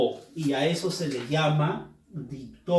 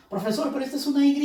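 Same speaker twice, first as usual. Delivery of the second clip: quick, much higher student voice; playful imitation of a student's objection; mock student; 10 semitones higher, 3 dB louder, much faster than usual. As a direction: rattle it off as an eager pupil.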